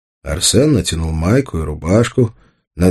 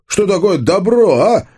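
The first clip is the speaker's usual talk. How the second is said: high-pitched heated challenge; barked challenge; 13 semitones higher than usual. A narrator's reading. Tolik challenges Arsen on what good means, barking. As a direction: push the question up high, aggressively. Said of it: "вдруг рявкнул Толик".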